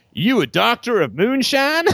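put-on a teasing Southern accent quip; mock Southern accent. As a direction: drawl it in a joking Southern accent. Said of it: "people hear a Southern accent".

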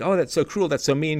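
high, whiny imitation of hurt people; whiny mock complaint. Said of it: "people think".

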